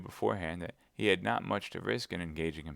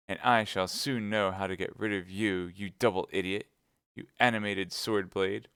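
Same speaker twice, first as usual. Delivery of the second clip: ordinary delivery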